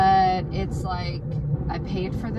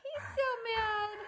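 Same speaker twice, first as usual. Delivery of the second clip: high pet talk; 14 semitones higher than usual. Real, not acted. Owner cooing at her grumpy dog.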